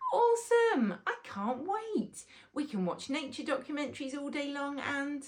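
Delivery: put-on a kid who can't wait; thrilled boy. Rory delighted by the Queen Bee's request, high excited child.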